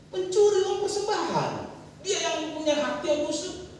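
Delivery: high-pitched, emphatic sermon delivery; fervent preacher exhorting a congregation; impassioned preaching. Real, not acted.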